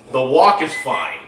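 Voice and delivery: ordinary talk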